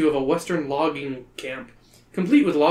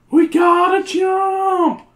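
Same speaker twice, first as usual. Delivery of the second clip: put-on very high, loud, slow girl voice; Patty's voice; 7 dB louder, much slower than usual. Reader using Patty's voice by mistake. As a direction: squeal it like Patty. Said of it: "That's the wrong voice".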